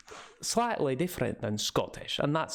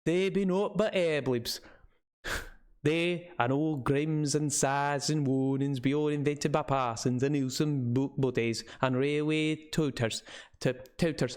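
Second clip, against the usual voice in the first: put-on old local man's northern English with Scots touches; rustic northern English, slipping toward Scottish; northern English dialect. Old man scoffing to visitors about warnings, northern English dialect. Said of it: "like a very northern English".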